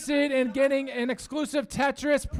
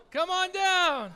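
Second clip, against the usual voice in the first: high, drawn-out game-show call; 6 semitones higher than usual. Real, not acted. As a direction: call the winner up to the stage. Calling winner up from the crowd.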